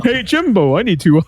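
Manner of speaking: high, laughing dad impression; goofy dad voice. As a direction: do a silly cartoon dad. Quotes Hugh Neutron, laughing.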